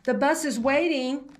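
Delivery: put-on teacher calling the kids to the bus. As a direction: call across to the class. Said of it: "her teacher called".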